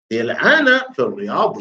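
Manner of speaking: normal talking voice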